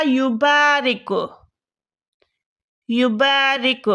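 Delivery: یبارک stretched out slowly, syllable by syllable; slow dictation. Shown as a demonstration. Teacher to students, slowly dictating the word. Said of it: "وہ کیا بنے گا؟".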